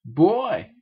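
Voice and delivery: put-on loud grumbling boy; a loud irritated grumble; grumbled. A narrator's reading. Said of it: "grumbled Marcus".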